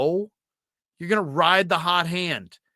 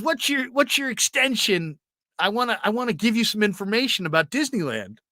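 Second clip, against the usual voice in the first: high, shouted gushing voice; excited Ralph; 7 semitones higher than usual. Retold or imitated. Coworker hearing about Disneyland trip, high excited gushing. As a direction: gush about Disneyland, pitched way up. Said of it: "he goes".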